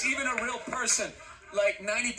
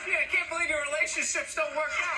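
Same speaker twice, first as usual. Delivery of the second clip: teasing taunt; 6 semitones higher than usual. Acted. Taunting Cody about his marriage.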